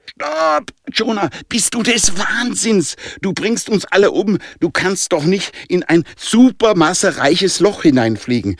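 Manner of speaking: very high-pitched shocked protest; panicked cry. A narrator's reading. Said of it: "schrie Andy angstgeschockt".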